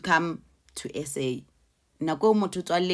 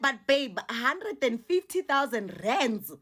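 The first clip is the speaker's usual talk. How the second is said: higher, disbelieving question; shocked disbelief; 6 semitones higher, slower than usual. Retold or imitated. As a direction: gasp at the sum, can't believe it. Shocked at the amount asked.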